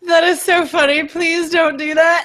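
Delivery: high, laughing protest through real laughter. Real, not acted. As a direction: burst out laughing while begging them not to. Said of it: "I'm sorry I'm laughing".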